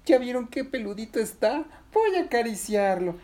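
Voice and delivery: high, excited little voice; high-pitched childlike delight; eager Patinina. Acted. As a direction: coo eagerly over a furry creature. Patinina eager to stroke caterpillar.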